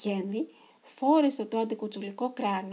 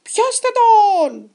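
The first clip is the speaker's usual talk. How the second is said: high, drawn-out squawking yell; shouted order; 10 semitones higher, 14 dB louder, much slower than usual. A narrator's reading. Biggest chicken pirate sending the gang after Henry, shouting. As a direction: shriek the command, stretching it out. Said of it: "φώναξε ο μεγαλύτερος".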